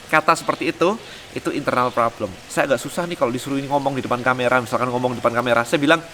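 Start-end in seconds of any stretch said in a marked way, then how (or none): none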